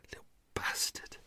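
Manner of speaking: under-the-breath whispered insult; annoyed whisper aside; whispered curse. Real, not acted. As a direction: hiss it at the bird outside. Muttered curse at noisy blackbird.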